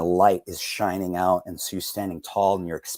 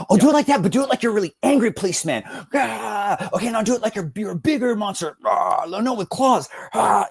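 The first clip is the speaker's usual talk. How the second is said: high, fast, eager directing; excited director; 14 semitones higher, 6 dB louder than usual. Retold or imitated. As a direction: pitch notes like a giddy director. Voicing the director's excited notes.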